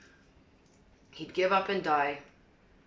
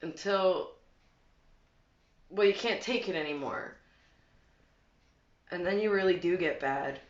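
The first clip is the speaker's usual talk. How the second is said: plain reading voice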